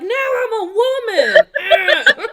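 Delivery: high, drawn-out comic shout; mock triumphant yell. Niece proclaiming womanhood to her uncle, hammed-up yell. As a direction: yell it way up high, half laughing.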